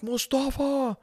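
high, softened falsetto call; mock distant calling. Father calling out for lost son.

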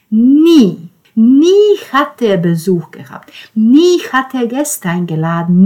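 Nie hit hard and high each time; stress on Nie. A narrator's reading. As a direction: lean on every Nie. Narrator stresses repeated never. (jedes Nie betont)

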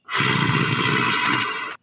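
long breathy dragon sigh. A narrator's reading. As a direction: exhale slowly like a weary dragon. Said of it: "before you hear a".

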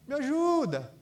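mock a grown man whining like a child; childish plea. Grown son calling to mom, mock childish plea. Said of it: "não sou mais criança pra".

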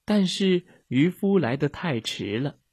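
usual speaking voice

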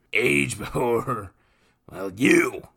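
high, loud boozy mockery; loud drunken brute teasing the boy; drunken brute mocking. A narrator's reading. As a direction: tease him like a drunk lout. Nathan voiced, mock courtesy.

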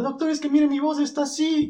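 very high squeak; squeaky high voice. Retold or imitated. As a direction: tell the doctor in a tiny high voice. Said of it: "Y le dice". (voz muy aguda ante el doctor)

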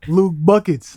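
loud hyped-up shout; hype call. Acted. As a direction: call out the nickname like a hype man. Hype nickname for a player.

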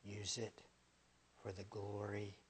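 soft, low whispered reverence, mostly unvoiced; hushed devotional. Preacher whispering to the congregation about eternity. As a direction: breathe it out like a quiet prayer. Hushed closing of a prayer-like passage.

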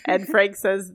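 normal talking voice

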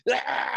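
high, strained yell of effort; acted rage scream. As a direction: scream as if choking an attacker. Acts out scream while choking attacker.

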